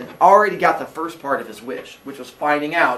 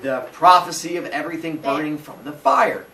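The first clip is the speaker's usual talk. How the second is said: ordinary delivery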